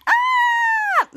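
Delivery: very high piercing scream. Acted. Shadow thrown to the floor, letting out a piercing scream. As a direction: shriek as you hit the floor. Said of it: "she screams on the floor".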